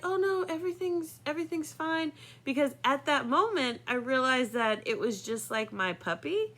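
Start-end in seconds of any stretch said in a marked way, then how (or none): 0.1-2.1 high, airy 'everything's fine' cover-up; forced breezy reassurance. Retold or imitated. Young girl waving off her friend's father, high and breezy. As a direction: brush it off in a bright, higher voice. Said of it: "in an effort just to, like, be like".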